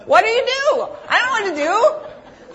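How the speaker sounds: high-pitched, loud squeal of helplessness; helpless exclamation. Retold or imitated. Speaker defending her awkward blunder to the audience, high helpless exclamation. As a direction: throw up a high, comic plea.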